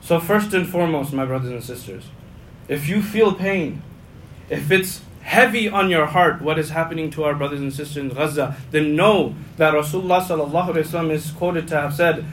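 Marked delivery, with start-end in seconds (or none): none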